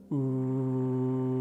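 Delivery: forceful buzzing ooh through pursed lips. Shown as a demonstration. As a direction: hum a strong ooh through rounded lips. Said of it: "So breathing in, I go".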